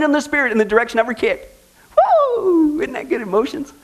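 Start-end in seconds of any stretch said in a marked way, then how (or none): 2.4-3.7 high fake whoop; mock cheer. Host mocking the Can Can lady with a whoop. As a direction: cheer like a fan, tongue in cheek.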